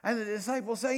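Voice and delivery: ordinary talk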